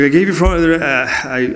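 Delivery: high, loud, flustered stammer; frantic explaining. Retold or imitated. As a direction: imitate a friend scrambling to explain. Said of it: "called me frantically".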